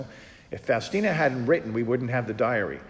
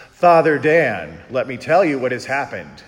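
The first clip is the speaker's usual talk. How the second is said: imitating Eleanor; 12 dB louder than usual. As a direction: bellow it like an overeager caller. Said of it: "this is how she talks to you".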